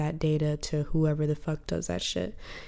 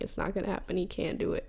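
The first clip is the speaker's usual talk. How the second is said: mocking the doubters; 7 dB quieter, faster than usual. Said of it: "Everyone's like".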